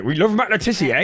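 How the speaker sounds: mock Janner accent, imitating a mate; thick Janner accent. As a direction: put on a broad Janner accent. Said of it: "in quite a thick Jano accent being like".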